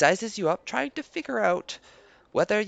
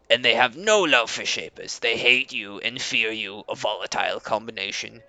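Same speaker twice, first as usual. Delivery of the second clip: Leader Koba, proud; 11 semitones lower than usual. Acted. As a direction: greet guests with stiff pride. Koba speaks as NPC.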